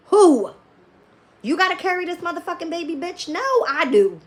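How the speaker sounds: high, loud challenge; indignant retort. Real, not acted. Answering critics of a possible pregnancy, indignant retort.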